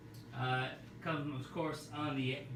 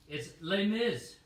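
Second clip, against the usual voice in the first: slow, louder, mock-annoyed announcement; oddly angry; 4 semitones higher, 5 dB louder, slower than usual. Real, not acted. Announcing the musical record, sounding oddly angry. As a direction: bite out the title, slow and a bit cross. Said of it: "I don't know why I'm getting angry".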